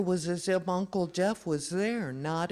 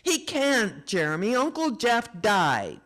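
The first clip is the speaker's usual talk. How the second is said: raised, raspy voice; loud and raspy; 6 semitones higher, 6 dB louder than usual. A narrator's reading. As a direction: blurt it out loud and rough. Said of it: "loud and raspy".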